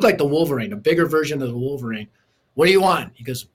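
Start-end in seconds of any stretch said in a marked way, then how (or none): 2.5-3.1 a rough, louder bark of a question; gruff uncle voice. Retold or imitated. Tough uncle answering the door, gruff bark. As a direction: sound like a tattooed tough guy at his door. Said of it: "Talk like this".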